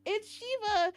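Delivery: high, starstruck introduction; nervous fan's high-pitched hello; shaky nervous greeting. Retold or imitated. Fan introducing herself to her idol, nervously. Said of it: "Like, I was shaking".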